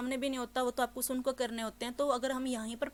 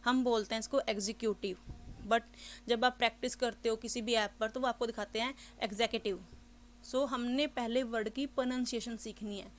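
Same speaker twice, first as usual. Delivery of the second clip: ordinary talk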